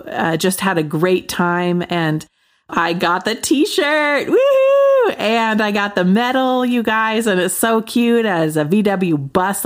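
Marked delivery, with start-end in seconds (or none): 4.3-5.2 high joyful whoop; celebratory woohoo. Real, not acted. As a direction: whoop with delight. Got the t-shirt.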